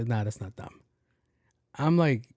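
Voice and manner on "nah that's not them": low, soft, offhand; a different guy, mumbling; cashier voice. Retold or imitated. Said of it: "the guy behind the register is like".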